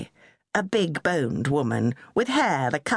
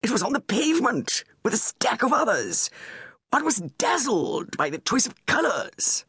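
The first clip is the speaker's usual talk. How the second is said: lightly raised, pompous royal voice; a fussy prince pleased with his purchase; Charles; 6 semitones higher than usual. A narrator's reading. Charles to Camilla about his bowl, lightly pompous.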